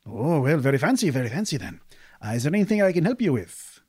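a merchant keen to help and sell; eager desert merchant. Acted.

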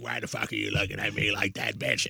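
imitating a demon, kept under the breath; inhuman demon voice. Imitating brother's road-rage outburst, inhuman demon voice. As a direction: slip into a voice no human makes. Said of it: "That's not a voice humans make".

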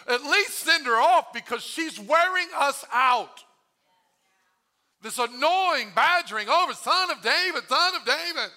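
loud, high, worked-up complaint; exasperated disciples. Acted. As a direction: protest as if worn out by her badgering. Fed-up disciples complain to Jesus.

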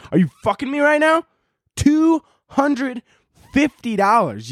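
high, strained outraged cry; outraged disbelief. Real, not acted. Vlogger ranting at the Keurig's price, high outraged disbelief. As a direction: voice jumps up in angry disbelief.

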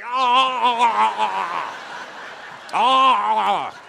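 hammed-up passion moans, ah ah ah; mock moaning. Comic to audience, mock lover's moaning. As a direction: moan it like a lover giving in.

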